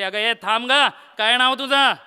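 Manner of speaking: loud call to stop someone leaving. Acted. God calling a departing woman back, loud call.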